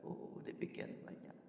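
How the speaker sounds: a whispered sigh of wonder at so much; awed whisper. Real, not acted. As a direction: breathe it out in a hushed whisper. Marvelling at God's many blessings.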